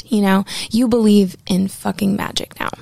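ordinary talk

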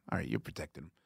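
low, soft muttered suspicion; muttered aside. Retold or imitated. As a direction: mutter it under your breath, knowingly. Teasing the Pro Tools guy.

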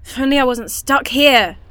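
building from a murmur to a loud desperate cry; spiralling into panic until it bursts out; panicked outburst. Acted. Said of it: "What was that?".

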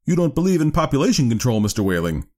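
high doctor's voice, dropping on the name; rather severe. A narrator's reading. Doctor towering over the father, rather severe. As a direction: challenge him severely, voice lifted. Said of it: "became rather severe with Whaling".

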